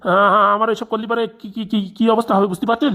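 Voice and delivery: flustered, stuttering protest; rattled, stammering objection; nervous stutter. Acted. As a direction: trip over repeated syllables as you protest. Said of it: "কেন এখন তুতলাচ্ছেন কেন?".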